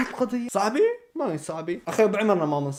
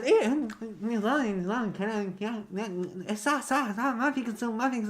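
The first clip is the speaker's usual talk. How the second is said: high, softer mocking voice; mocking imitation; 6 semitones higher, 3 dB quieter than usual. Vlogger parodying the guests agreeing, high mock voice. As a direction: lightly parody their agreeing chatter. Mimicking clip speakers.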